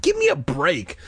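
high, exasperated protest; mild scream. A narrator's reading. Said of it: "she screamed".

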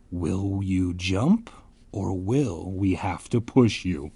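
slow, eerie villain voice; hammed-up creepy kid; creepy threat. Creepy girl threatens at ear.

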